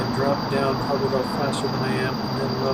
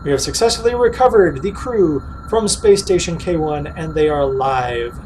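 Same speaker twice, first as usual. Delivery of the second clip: mission control report; 2 semitones higher, 5 dB louder than usual. Acted.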